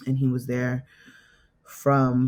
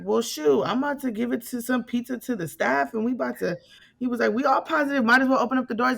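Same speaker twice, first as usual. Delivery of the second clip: lightly higher, joking dad voice; jokey dad, pitched up a bit; playful dad voice; 9 semitones higher, faster than usual. Retold or imitated. Dad joking about a pizza party.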